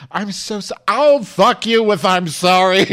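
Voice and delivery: high loud mock apology, slowed; mocking shout. Mocking the apology while shouting: loud sneer. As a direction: sneer back at him.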